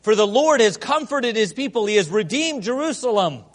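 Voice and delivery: loud, high ringing proclamation; scripture read at full preaching force; proclaimed scripture. Acted. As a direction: cry it out like a prophet. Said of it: "He cries out to us".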